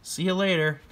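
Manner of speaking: high, cheery, dismissive mock thanks; breezy brush-off. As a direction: wave him off with a bright fake smile. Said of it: "and they're like".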